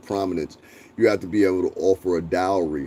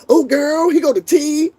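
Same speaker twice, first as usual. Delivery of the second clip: very high, loud sassy falsetto; mocking a campy "girl" voice he refuses to use; camp gossip voice; 6 dB louder than usual. Shown as a demonstration. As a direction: flip into a hammed-up sassy gossip. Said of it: "I don't talk like that".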